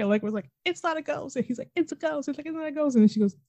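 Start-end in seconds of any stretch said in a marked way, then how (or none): 0.7-1.3 high-pitched retold denial; mock insisting. Retold back-and-forth argument. 1.8-2.3 high-pitched retold comeback; mock insisting. Retold back-and-forth argument. 2.5-2.9 high-pitched retold denial; mock insisting. Retold back-and-forth argument.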